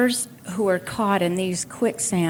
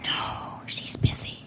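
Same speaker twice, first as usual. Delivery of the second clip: tiny whispered no; hushed child's whisper; 7 dB quieter than usual. Retold or imitated. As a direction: whisper it like a small child hiding. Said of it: "And still whispering".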